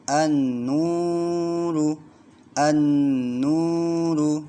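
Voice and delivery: an-nuru, nn ditahan sangat pelan; held gunnah. Shown as a demonstration. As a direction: tahan nn di an-nuru dua ketukan. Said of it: "nunnya ini ditahan dua ketukan". (an-nuru dengan dengung panjang)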